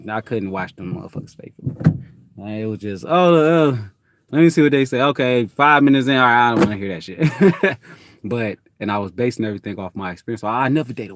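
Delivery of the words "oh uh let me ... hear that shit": higher and louder, a hammed-up 'let me see'; mock eager viewer. Mocking a viewer who quits five minutes in, higher and louder. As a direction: play the curious viewer who quickly gives up. Mocking someone checking a show.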